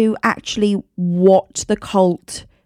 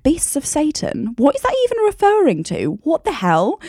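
puzzled; 7 semitones higher than usual. Retold or imitated. Quoting own puzzled self.

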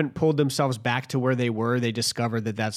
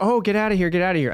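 loud, high mock shooing; imitating urgent shooing; 8 semitones higher, 7 dB louder, faster than usual. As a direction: mimic them hurrying someone out, pitched up. Mimicking people shooing him out.

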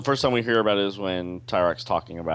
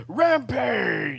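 fighting game announcer. Real, not acted. Echoing the word as a shout.

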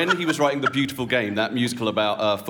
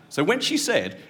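usual speaking voice

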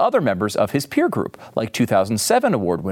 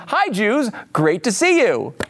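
a lightly voiced, higher, cheery politician greeting; host mocking Cuomo's wave, in a cheery higher greeting; 8 semitones higher, slower than usual. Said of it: "That's just Andrew saying".

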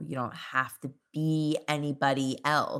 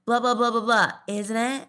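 higher, louder mock-British babble; mock British accent; 6 semitones higher, 5 dB louder than usual. Guest mocks her own London habit in a put-on British accent. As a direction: babble in an affected London accent, landing the tag question. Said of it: "one of those Americans who visits London once".

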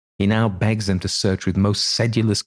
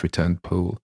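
usual speaking voice

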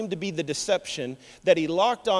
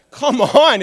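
loud, high exasperated protest; 7 semitones higher, 11 dB louder than usual. Real, not acted. Preacher scoffing at the goat hair trick, exasperated. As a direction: exclaim it in disbelief, voice jumping up.

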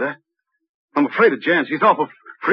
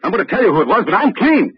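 higher and louder, scared and pleading; scared outburst; 6 semitones higher, 5 dB louder than usual. Acted. Suspect pleading with detectives, loud and scared. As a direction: blurt it out to save your own skin.

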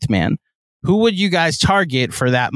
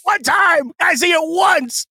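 Mad Dog impression; 13 semitones higher than usual. Continues Mad Dog rant impression.

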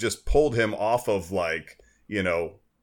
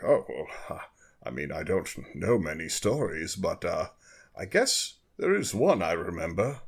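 Picard telling bedtime story; 2 semitones higher than usual. Acted. Picard reluctantly telling the captain a bedtime story, mock-solemn storyteller voice. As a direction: play Picard easing into a grand tale. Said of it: "wants Picard to tell him a bedtime story".

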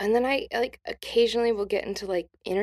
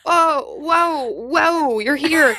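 higher, astonished gasps of delight; amazed surprise; 7 semitones higher, 4 dB louder, slower than usual. Retold or imitated. Brothers greeting dead grandma in the dream, higher and amazed. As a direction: act stunned to see her ghost.

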